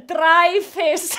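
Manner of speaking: emphatic Icelandic-accented reading; loud, demonstrative Icelandic accent. Shown as a demonstration. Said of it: "read it in my accent".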